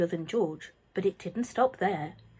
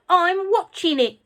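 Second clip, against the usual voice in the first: shouting; 10 semitones higher, 8 dB louder than usual. A narrator's reading. Grandma shouting.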